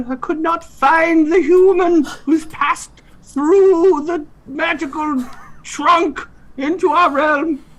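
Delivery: very high, squeaky fairy creature; high fantasy insect voice. Acted. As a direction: squeak like a tiny winged creature. Eighties fantasy scene, insect creature.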